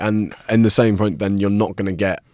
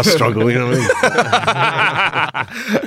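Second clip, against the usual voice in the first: high, drawn-out laughing; 7 semitones higher, much slower than usual. Real, not acted. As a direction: laugh it out slowly. Laughing at own remark.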